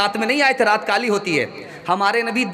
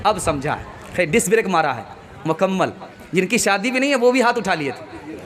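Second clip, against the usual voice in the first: ordinary delivery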